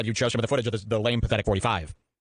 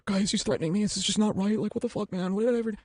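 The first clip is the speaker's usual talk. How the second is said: high mock whining complaint; whiny victim; 9 semitones higher than usual. Host mocking himself whining to the audience, high and whiny. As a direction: whine like a wounded victim, pitched up. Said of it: "I just went".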